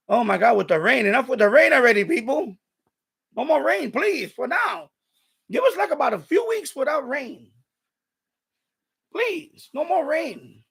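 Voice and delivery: high, fed-up begging; exasperated pleading. Real, not acted. Fed up with endless rain.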